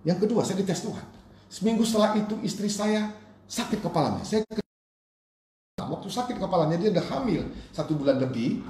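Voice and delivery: high-pitched, urgent testimony; fervent preaching. Real, not acted. Preacher telling the congregation of his second test, fervent and high. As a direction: lift the voice high and press the story on the congregation.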